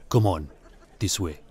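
low, soft warning in character; gallery owner. A narrator's reading. Gallery owner warning the intruder off, low and quiet. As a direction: keep it down, controlled and discreet.

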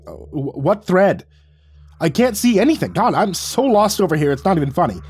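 high-pitched, exasperated confusion; stammer, then burst out helplessly. A narrator's reading. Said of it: "I stammered".

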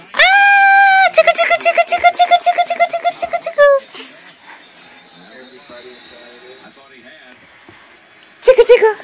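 high squealing tickle, tickle; giggly tickle attack. Real, not acted. Playful tickle attack on child.

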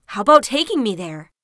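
loud, eager wolf voice; hopeful wolf. A narrator's reading. Wolf hearing about the banquet, loud and hopeful. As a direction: burst out loudly with hope. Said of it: "said the wolf hopefully".

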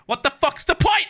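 high-pitched, fed-up question; worked-up, incredulous griping; exasperated demand. Real, not acted. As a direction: demand a reason, voice jumping up. Exasperated at plot inconsistency.

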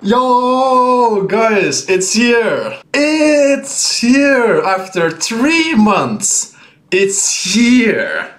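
high, drawn-out excited shouting; hyped announcement. Real, not acted. Streamer telling chat the long-awaited mod is here, hyped. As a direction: cry it out, stretching the words high.

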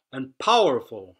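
loud, proud trumpeting; mildly trumpeting elephants; trumpeted. A narrator's reading. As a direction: boom it out like elephants. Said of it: "trumpeted the elephants".

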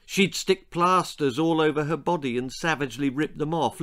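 ordinary delivery, nothing marked

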